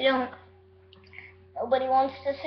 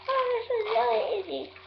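cheeks stuffed full; 9 semitones higher than usual. Real, not acted. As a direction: mouth full of marshmallows. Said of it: "I got four in here so far".

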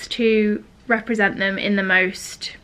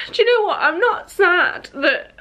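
tearful; 5 semitones higher than usual. Real, not acted. Upset vlogger telling viewers about trolls, tearful. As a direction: voice catching, near tears.

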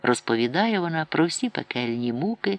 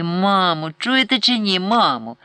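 louder, higher urgent call; a boy insisting, louder and higher; impatient pleading; 6 semitones higher, 5 dB louder than usual. A narrator's reading. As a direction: tug at his busy mother.

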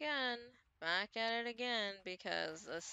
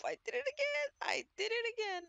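excited shout; 13 semitones higher, 5 dB louder, faster than usual. Real, not acted. Triggered trap again.

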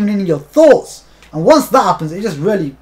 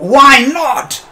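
real exasperated shout, higher and louder; frustrated cry; 6 semitones higher, 6 dB louder than usual. Real, not acted. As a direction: demand an answer from the game. Repeated in frustration.

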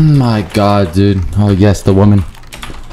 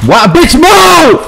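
high, drawn-out yell; impatient yell; slower than usual. Real, not acted. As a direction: yell it high and stretch the last word. Yelling at someone in game.

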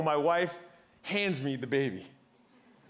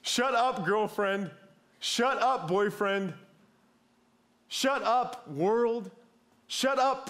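higher, insistent "Shut up" each time; dismissive shout; 5 semitones higher, slower than usual. Retold or imitated. A burned-out worker shutting out the world, repeated mock "shut up". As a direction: brush everyone off with a "shut up".

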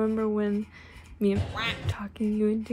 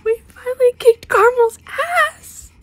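much higher, much louder joyful yell; shrieking with delight over the win; ecstatic shout; 12 semitones higher, 11 dB louder than usual. Real, not acted. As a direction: scream it out in triumph. Friend cheers about beating rivals.

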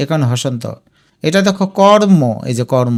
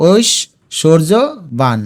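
ঐশ্বর্যবান as oish, shor-jo, ban; slow ঐশ্বর্যবান with a gap between parts; broken into parts; much slower than usual. Shown as a demonstration. Teacher shows how to split for spelling, ঐশ্বর্যবান in slow parts. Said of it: "ভেঙে ভেঙে লিখবে".

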